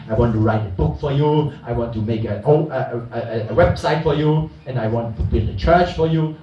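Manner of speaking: lightly voiced proud promiser; boastful big man. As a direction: puff up like a man promising grand deeds. Mocking proud grand promises.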